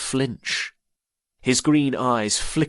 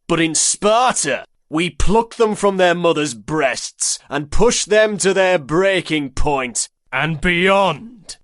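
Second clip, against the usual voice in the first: stern Spartan orator; 8 semitones higher, 6 dB louder than usual. Acted. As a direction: lecture the crowd with proud contempt. Gaius addresses crowd.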